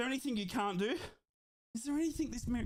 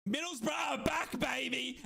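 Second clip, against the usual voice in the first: triumphant call; 3 semitones higher, slower than usual. Real, not acted. Celebrating the comeback.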